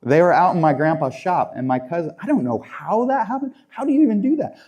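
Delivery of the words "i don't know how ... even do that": incredulous. Real, not acted. Amazed at the cousin's stunt.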